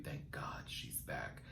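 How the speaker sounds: a breathless, hushed aside of relief; relieved whisper. Real, not acted. Host whispering to viewers that Raven is back, hushed. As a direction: whisper it under your breath.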